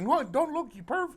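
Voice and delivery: high, teasing rebuke; wife teasing husband for looking, high and mock stern; mock scolding. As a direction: snap playfully at the husband. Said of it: "she's like".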